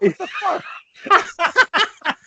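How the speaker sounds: drawn out high, slow what the fuck; a slow high disbelieving cry; stunned. Real, not acted. Stunned at stolen joke.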